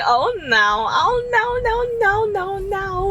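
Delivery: high, laughing wail of dismay; mock-distressed cry through laughter.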